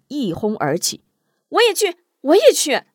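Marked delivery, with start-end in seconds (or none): 1.5-2.8 high, loud clamoring; eager shout. A narrator's reading. Han Jing and others clamoring to go, high loud shout. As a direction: beg to come along.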